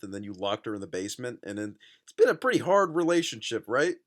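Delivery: usual speaking voice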